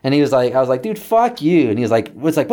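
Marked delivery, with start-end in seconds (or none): 0.8-1.7 high-pitched acted curse; exasperated retold dismissal; angry retort. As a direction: snap back in mock outrage. Acted phone quarrel with dad.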